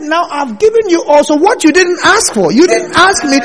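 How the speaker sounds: high, loud, ringing sermon delivery; voicing God to Solomon, booming preacher voice; full-force preaching. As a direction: preach it out to the whole church.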